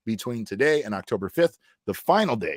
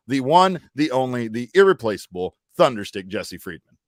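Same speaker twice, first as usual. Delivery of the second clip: hype announcer intro; 4 dB louder than usual. Acted. Host hypes his co-host's introduction.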